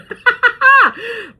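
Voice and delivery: loud, high burst of laughter; real laugh at herself. Real, not acted. Laughing at her own rambling, high and loud. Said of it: "why am I telling y'all this?".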